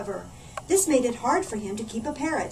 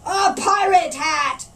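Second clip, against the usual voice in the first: slow, loud pirate roar; 7 semitones higher, 9 dB louder, slower than usual. A narrator's reading. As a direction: bellow the demand at the tailor. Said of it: "roared Backbeard".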